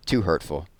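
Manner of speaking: ordinary delivery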